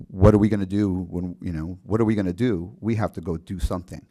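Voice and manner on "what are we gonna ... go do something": ordinary talk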